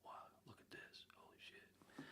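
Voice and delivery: hushed, breathless whisper of amazement; a stunned whisper to himself; awed whisper. Retold or imitated. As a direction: whisper it like you can't believe your eyes. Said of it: "two, three feet away going".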